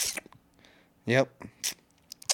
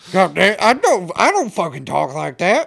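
high, loud put-on voice; offended [name]; 7 semitones higher, 8 dB louder than usual. [name] hearing the imitation of himself, mock offended. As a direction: protest in hammed-up outrage at being imitated. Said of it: "he's gonna be like".

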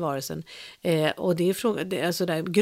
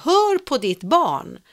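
much higher, louder, very slow and deliberate; pretend stern command; 8 semitones higher, 6 dB louder, much slower than usual. Friend imagining scolding the exercising mother, stern. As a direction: lay down the law word by word.

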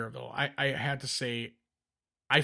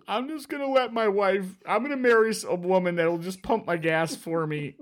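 put-on higher, teary child's voice, a bit louder; crying kid; 5 semitones higher, 4 dB louder than usual. Dad imitating his crying son swearing off pumping gas, teary higher voice. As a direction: sob out the vow like a hurt boy. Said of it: "he's crying".

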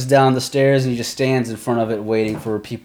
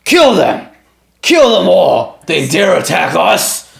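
higher, louder furious command; war chief's battle cry; 6 semitones higher, 6 dB louder, slower than usual. Acted. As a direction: order the charge in fury. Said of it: "he looks over at Rogar".

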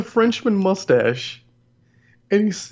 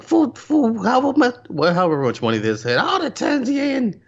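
put-on weird Toad accent; 8 semitones higher than usual. Mocking a cartoon sidekick to a co-host, weird accent. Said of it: "he's got this weird accent".

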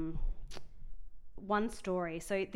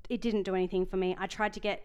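ordinary talk

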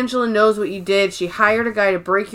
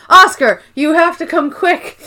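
urgent call; 6 semitones higher, 5 dB louder than usual. Retold or imitated. Dwight urgently fetching Oscar.

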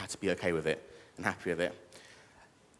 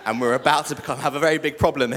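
louder, higher, laughing through the words; 5 semitones higher, 7 dB louder than usual. Real, not acted. Speaker joking with the congregation about the scooter, laughing. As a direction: crack up while getting the words out. Joking after crowd's reaction.

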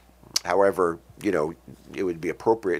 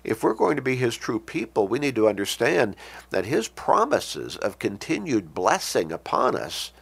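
ordinary talk

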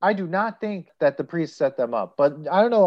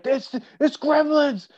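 much higher, slow put-on movie voice; quoting the movie line; 11 semitones higher, slower than usual. Host quoting the film's title line, hammed-up high voice. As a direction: deliver the title line with mock drama. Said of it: "the titular line".

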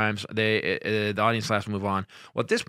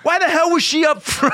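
loud, high yell of protest; indignant retort; 8 dB louder than usual. Retold or imitated. Comic defending himself to fellow comedian, loud indignant protest. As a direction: yell it as a self-justifying punchline.

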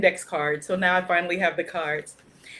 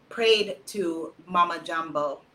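ordinary talk

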